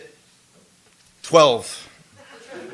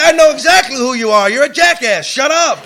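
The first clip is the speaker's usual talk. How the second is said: loud, high, harsh telling-off; angry scolding; 5 semitones higher, 5 dB louder than usual. Retold or imitated. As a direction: bark it at a cocky kid. Sponsor yelling at cocky newcomer.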